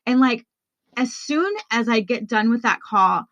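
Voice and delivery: ordinary delivery, nothing marked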